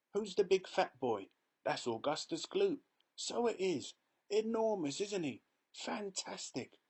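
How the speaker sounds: high, lively onlooker voices; gossiping crowd. A narrator's reading. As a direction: gossip eagerly about the kids.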